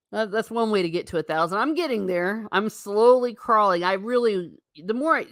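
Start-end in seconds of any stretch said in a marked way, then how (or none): none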